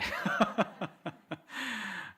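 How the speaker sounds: high, bubbling laugh; high chuckle in a run; amused laugh. Real, not acted. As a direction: laugh at your own joke. Amused at own Avengers joke.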